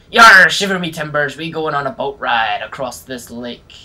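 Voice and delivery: loud pirate "yar". Pretend. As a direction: ham up a pirate captain. Pirate talk on a boat.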